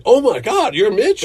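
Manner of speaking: high, loud gasp of recognition; suddenly starstruck; excited surprise. Retold or imitated. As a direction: guests realising who he is, excited. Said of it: "And they're like".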